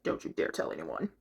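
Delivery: low hushed threatening villain voice; menacing villain whisper. Retold or imitated. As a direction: hiss it like a villain guarding his secret.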